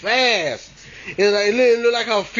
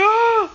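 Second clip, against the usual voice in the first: frightened scream. Retold or imitated. Boy shrieking as he runs off. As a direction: shriek and run. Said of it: "And I was like".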